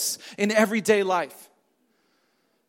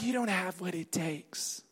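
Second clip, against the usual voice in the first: low, very soft insinuation; stranger's lying voice; 6 semitones lower, 14 dB quieter than usual. Acted. Preacher voicing the stranger's lie, low and hushed. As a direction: murmur it like a discouraging inner voice. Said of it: "stranger's voice sounds something like".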